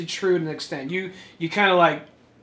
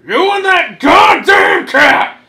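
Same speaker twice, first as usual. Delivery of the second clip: high, slow, fed-up yell; exasperated shout; 12 semitones higher, 13 dB louder, much slower than usual. Acted. Exasperated outburst at cat.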